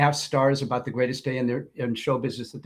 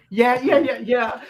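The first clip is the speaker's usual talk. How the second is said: higher, louder knowing yeah; mocking manager; 9 semitones higher, 4 dB louder, slower than usual. Manager confirming he is on shift, higher and knowing. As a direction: toss off a knowing yes. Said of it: "He's like".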